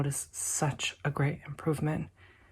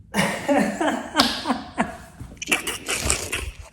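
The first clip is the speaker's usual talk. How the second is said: excited laugh; 9 semitones higher than usual. Real, not acted. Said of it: "I got excited".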